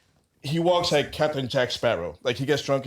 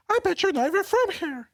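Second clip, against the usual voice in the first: put-on drunk regular's high voice. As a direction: squeak it out like the drunk patron. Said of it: "his voice gets all high".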